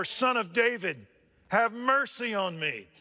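loud, high preaching cry; a beggar crying for mercy, pitched high and loud. Acted. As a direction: call out for mercy at full force. Preacher voicing the blind man's cry.

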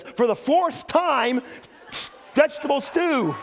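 wife answering her husband at last, in mock exasperation; exasperated retort. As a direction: spell it out like she's said it three times already. Wife snaps back, exasperated punchline.

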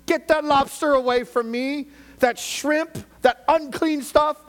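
high, loud hammed-up shout of refusal; acted outraged refusal. Preacher acting Peter refusing lobster and shrimp, hammed-up outraged shout. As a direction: loudly play Peter recoiling from unclean food. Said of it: "this is what he would do".

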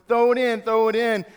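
high, loud shout from the stands; parents yelling. Retold or imitated. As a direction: yell it like parents in the bleachers. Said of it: "in the stands start yelling".

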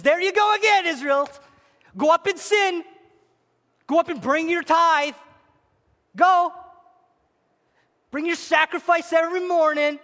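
high, loud mocking taunt; sarcastic God. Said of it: "His very sarcasm moment".

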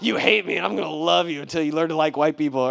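loud, high mock declaration of love; teasing. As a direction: tease him with stubborn affection.

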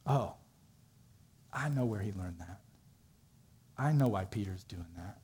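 low, hushed moment of realisation; quiet thinking aloud, with pauses between thoughts; dawning realisation. Acted. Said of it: "I think it dawned on him".

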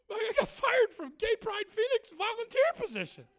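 very high, loud mock wail; hammed-up upset outburst; wailing protest. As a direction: whine it at the top of your voice. Tearful retort about being fired.

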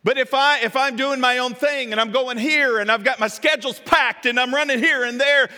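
loud, high, rushing-about delivery; harried and busy. Acted. Preacher playing someone with a packed schedule, harried and loud. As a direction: pile it up at high volume. Acts out being too busy.

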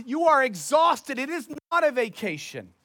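usual speaking voice

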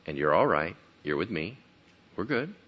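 low, soft, calming words; gentle reassurance. Retold or imitated. Jesus reassuring his terrified disciples, gentle and soft. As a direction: settle them down quietly.

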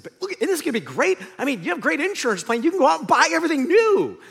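fast, high, loud mock enthusiasm; tactless cheer. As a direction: gush obliviously, building to a high finish. Tactless cheer to a grieving volunteer.

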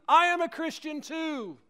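high loud declaration; crying out loudly. A narrator's reading. Guard joins the men on the ice, crying out loudly. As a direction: fling it out across the ice. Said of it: "crying out loudly".

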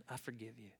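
very soft, low acted pardon; hushed, subdued concession; quiet forgiveness. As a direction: let it go quietly. First time forgiving the thief.

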